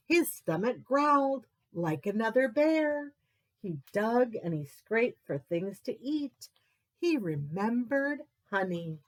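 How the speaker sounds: usual speaking voice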